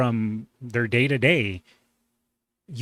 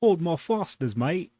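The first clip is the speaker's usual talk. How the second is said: higher-pitched, badly faked Aussie accent; bad Australian accent; 6 semitones higher than usual. Reactor riffs on the streamer's joke as Australia, bad Australian accent. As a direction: put on a rough Australian accent. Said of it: "That was a bad accent".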